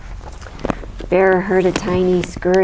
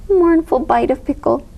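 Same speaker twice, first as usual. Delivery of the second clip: ordinary delivery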